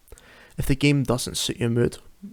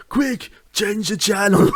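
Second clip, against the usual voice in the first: quoted movie line; 8 semitones higher, slower than usual. Acted. Quoting a favourite movie line.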